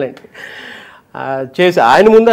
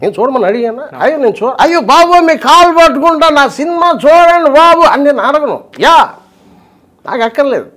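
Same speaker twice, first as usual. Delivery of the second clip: nonchalant, reckless director voice; imitating RGV; 5 semitones higher than usual. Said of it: "Jagan గారి ముందు ఆర్జీవిది".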